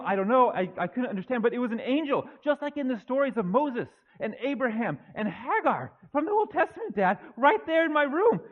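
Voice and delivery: breathless, eager son phoning his dad with big news; excited young Joseph. Acted. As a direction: tumble the words out like a thrilled young man. Preacher plays excited young Joseph.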